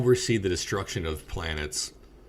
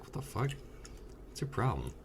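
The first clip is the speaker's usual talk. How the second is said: hushed scolding; 3 semitones higher, 10 dB quieter than usual. Retold or imitated. Fiancée's hushed scolding in theater.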